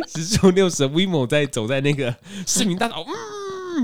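talking through real laughter; laughing. Real, not acted. Telling the scooter story, laughing through it. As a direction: laugh while getting the words out.

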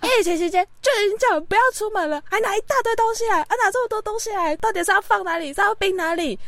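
high-pitched retold rant. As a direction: rant at the parents, exasperated. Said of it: "我就开始狂飙他们". (对爸妈高声抱怨)

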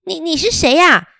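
high, startled little mother voice; a nervous mama hedgehog questioning a stranger. A narrator's reading. Hedgehog mother questions the wolf, high and startled. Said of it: "刺猬妈妈哦，就跑出来说".

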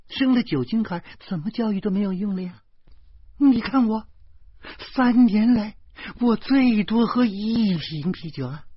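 high-pitched, insistent protest; heated self-defence. A narrator's reading. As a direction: plead your case, pitched right up. Acted heated self-defence in story. (激动地高声辩解)

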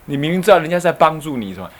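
usual speaking voice

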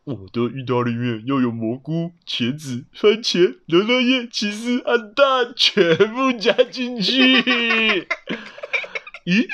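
greedy, rising character voice; eager hungry animal. Acted. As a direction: list toppings with building excitement. Animal voiced listing toppings.

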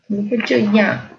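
ordinary delivery, nothing marked

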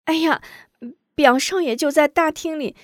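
high urgent servant voice; flustered high maid; anxious maid. Acted. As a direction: blurt it out nervously. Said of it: "秋菊着急道".

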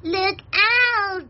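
high-pitched rabbit warning; high, drawn-out storybook cry. Acted. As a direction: call the alarm in a high voice. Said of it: "Cried Rabbit".

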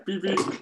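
high-pitched vocal bleep; mock censor beep. Co-host covering up the name just said, mock beeping.

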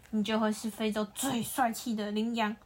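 plain reading voice

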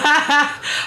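short high-pitched burst of laughter; amused laugh. Real, not acted. Co-host admitting they know only that group, amused laugh. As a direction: laugh at your own ignorance. Co-hosts admit knowing little idol trivia.

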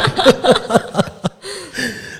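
a high-pitched giggle fit; just crack up; high burst of laughter. Real, not acted. Laughing at the weird family. (高声大笑)

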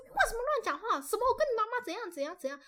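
angry aunt scolds the child, lightly voiced; scolding aunt. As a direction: imitate the aunt scolding a kid. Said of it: "她就骂我啊".

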